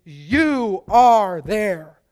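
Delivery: very slow and loud, each word drawn out: "You are there"; emphatic. Shown as a demonstration. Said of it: "it's emphatic".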